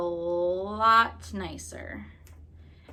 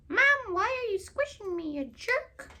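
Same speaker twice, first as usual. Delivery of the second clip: high squeaky little voice; squeaky pumpkin voice; 13 semitones higher, 4 dB louder than usual. Pretend. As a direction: voice the pumpkin complaining, high-pitched. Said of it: "He's pushing up on me".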